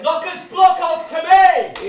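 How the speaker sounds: long loud slow call to the field; stadium yell. Acted. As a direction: bellow it across the ground. Yelling across the ground.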